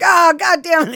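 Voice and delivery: high, loud comic outburst; mock exasperated cry. Attackers slipping on the mountain, loud mock dismay. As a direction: yell it in cartoonish dismay.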